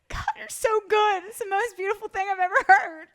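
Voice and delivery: high, loud, tearful mock gushing; hammed-up sobbing admiration; Will Ferrell. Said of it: "like Will Ferrell and Step Brothers".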